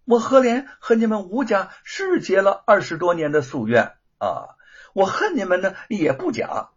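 scornful retort. Acted. Thief scorns the Wu family.

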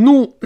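"nu" as plain "noo", no y at all; wrong, no glide. Shown as a demonstration. Teacher warns against this reading, "nu" without glide. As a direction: say "nu" like a loud "noo". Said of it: "不能读成nu".

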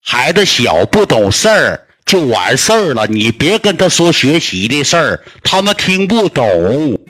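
very slow, drawn-out, emphatic words; slow and deliberate, each phrase spaced out; talking into a deaf ear. Retold or imitated. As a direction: spell it out slowly for a hard-of-hearing grandpa. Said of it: "一顿跟我姥爷搁耳朵跟前我念叨".

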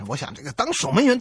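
normal talking voice

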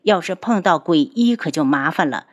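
usual speaking voice

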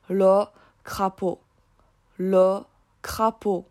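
"Le crapaud" slowly, in careful French; French pronunciation demo. Shown as a demonstration. Host shows listeners French for toad, slow careful pronunciation. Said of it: "法语的癞蛤蟆是怎么说的".

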